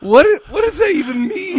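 high, loud, baffled what; baffled squeal. Retold or imitated. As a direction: shout out in mock confusion. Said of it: "I'm like".

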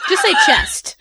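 usual speaking voice

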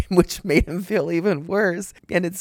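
giggling, barely holding it together; laughing. Real, not acted. Host retelling the wife's answer, laughing.

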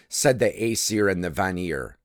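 plain reading voice

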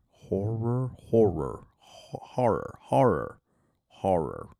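"horror" repeated, trying different pronunciations; "horror" sounded out several times; practicing the word horror. Shown as a demonstration. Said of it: "never really been confident in my ability to say the word horror".

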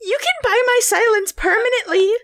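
high cutesy voice; coy Discord kitten bargaining. Pretend. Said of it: "doing this".

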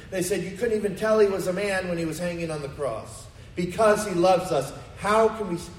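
impassioned sermon build; fiery preaching. Acted. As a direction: drive the point home to the congregation. Preaching climax.

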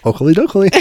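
high, chirpy cartoon sing-song; Flanders. Co-host compares kid to Flanders, playful impression. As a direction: do a playful cartoon-neighbour catchphrase. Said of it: "If our kid is Flanders".

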